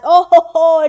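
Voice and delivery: loud, rising oh ho ho of discovery; excited realisation. Real, not acted. Caster realising who Fury is, loud excited oh ho ho. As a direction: burst out as it clicks.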